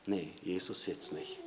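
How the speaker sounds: low, soft muttered refusal; dismissive brush-off. Acted. Preacher voicing a man caught watching porn, muttered refusal. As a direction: shoo him away under your breath. Acting out the person refusing Jesus.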